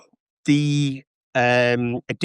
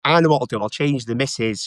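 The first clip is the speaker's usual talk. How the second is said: ordinary talk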